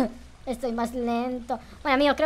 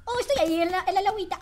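startled; 12 semitones higher, 8 dB louder than usual. Real, not acted. Zombie appears suddenly.

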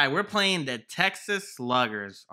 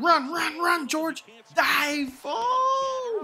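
urgent cheering; 13 semitones higher, much slower than usual. Real, not acted. Gamer urging his runner to the base, high urgent yells. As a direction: yell at the runner to hurry.

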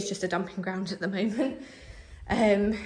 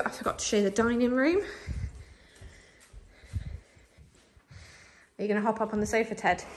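out of breath; 4 dB quieter, faster than usual. Real, not acted. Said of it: "sorry I get so out of breath now".

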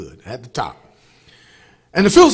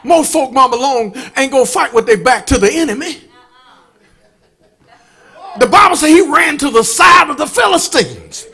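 loud, rising gospel preaching; full-force preaching; 8 semitones higher, 6 dB louder than usual. Acted. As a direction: preach it hard to the congregation.

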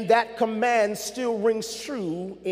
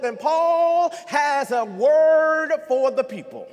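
preaching build; 3 semitones higher, 3 dB louder than usual. Acted. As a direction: drive it home to the congregation.

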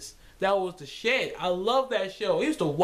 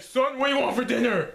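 dad voice; 5 semitones higher, 3 dB louder than usual. Retold or imitated. Dad asks chicken in skit.

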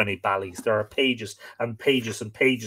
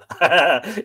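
amused laugh; 6 semitones higher, 8 dB louder than usual. Real, not acted. Reader amused by Ballyscandal in Armagh, bursting out laughing. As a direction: burst of real laughter, higher and louder.

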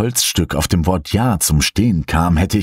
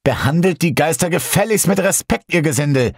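viel höher, übertrieben empört; gespielt theatralisch; 10 semitones higher than usual. A narrator's reading. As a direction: tadeln wie ein Schmierenschauspieler. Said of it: "mit einem gespielt theatralischen Ton". (hoch und theatralisch tadelnd)

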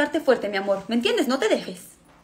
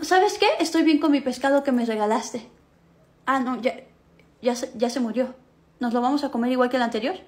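ordinary talk, nothing marked

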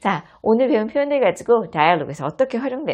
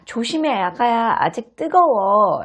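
caring warning; 5 semitones higher, 5 dB louder, slower than usual. Acted. Mom warning baby.

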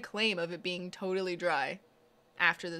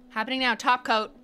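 fast, loud, higher announcement of the step; excited live update; 3 semitones higher, 7 dB louder than usual. Real, not acted. To viewers, announcing the robot starting, excited. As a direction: call out each step as it happens.

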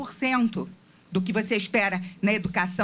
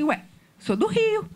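higher, slow, puzzled "Ué" with a crack of disbelief; puzzled surprise; 10 semitones higher, slower than usual. Retold or imitated. Retelling how he answered his neighbors, puzzled surprise. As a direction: act baffled that anyone could doubt it. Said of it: "Eu falei assim".

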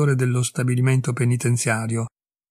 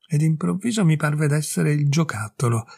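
usual speaking voice

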